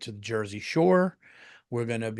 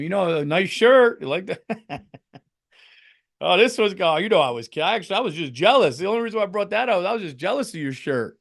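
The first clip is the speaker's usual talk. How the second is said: higher, louder nervous flattery; mock awkward backpedal; 7 semitones higher, 7 dB louder than usual. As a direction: scramble to take back the joke. Awkward apology to teacher.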